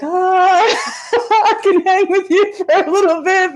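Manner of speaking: high, laughing mock bravado; laughing self-quote. Retelling keeping up with Nicole, high and laughing. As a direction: giggle through a cocky boast. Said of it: "and I'm like".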